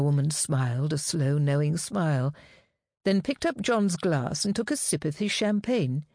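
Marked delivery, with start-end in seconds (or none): none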